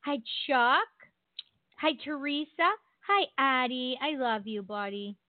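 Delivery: ordinary delivery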